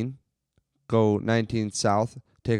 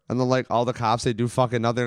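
ordinary delivery

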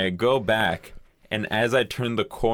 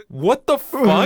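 loud, high, drawn-out disbelief; baffled outburst; 13 semitones higher, 9 dB louder, slower than usual. Retold or imitated. Shopper reacting to stranger handling creamer, baffled. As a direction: exclaim in incredulous amazement, stretching it out.

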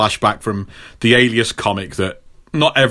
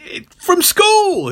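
very high, slow prompting; Jessica; much slower than usual. Retold or imitated. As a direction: jog his memory in a high imitation voice. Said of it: "And she's like".

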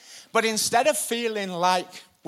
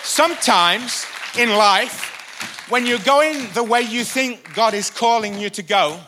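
rising, loud, higher preacher's build; fiery preaching; 6 semitones higher, 7 dB louder than usual. Acted. Preaching builds to full force.

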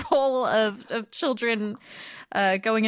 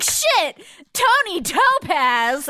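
excited disbelief; 7 semitones higher, 4 dB louder, much slower than usual. Retold or imitated. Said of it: "I was like".

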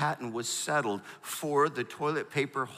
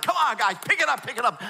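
high, louder, exasperated urging; ticked off; 7 semitones higher, 4 dB louder than usual. Retold or imitated. Softball player to error-making infielders in the dugout, ticked off. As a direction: re-enact a frustrated player chivvying teammates. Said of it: "I'm getting ticked".

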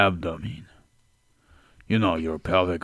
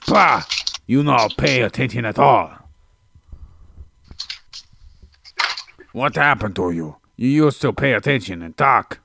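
loud, higher, broken-English scold; mock scolding character; 4 semitones higher, 3 dB louder than usual. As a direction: chew them out in a funny voice.

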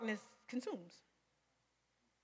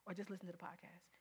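soft, coaxing whisper of temptation; a hint of a soothing tempter; tempter's voice; 5 semitones lower, 16 dB quieter, faster than usual. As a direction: lull them into staying home. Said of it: "That's the strategy".

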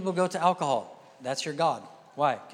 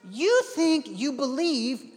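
raised, slow, pointed retort; emphatic retort; 8 semitones higher, slower than usual. Retold or imitated. Preacher voicing himself confronting woman.

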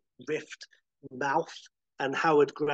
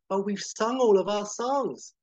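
flustered singer; 8 semitones higher, 6 dB louder than usual. Acted. Ronette flustered.

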